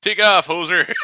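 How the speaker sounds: a laughing Canadian hockey-fan brush-off; laughing Canadian accent. Retold or imitated. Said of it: "hear that accent".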